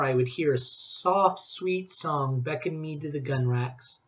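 plain reading voice

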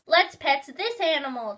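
eager little brother wanting to pet; excited. A narrator's reading. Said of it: "Justin said excitedly".

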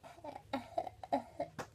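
soft, breathy chuckle; light laugh. Real, not acted. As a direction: laugh quietly to yourself. Laughing after a joking Bam.

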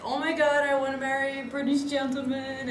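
a starstruck American girl, hammed up; American accent. American girl gushing over British men, mock American accent. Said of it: "I can't do the American accent well".

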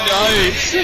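loud laughing cheer. Real, not acted. Laughing after winning the fight.

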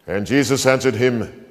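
normal talking voice

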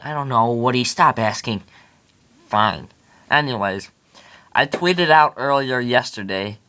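ordinary talk, nothing marked

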